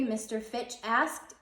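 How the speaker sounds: ordinary delivery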